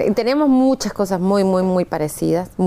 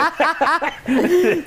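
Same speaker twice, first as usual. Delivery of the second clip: laughing; 7 semitones higher than usual. Real, not acted. Guest laughs at joke.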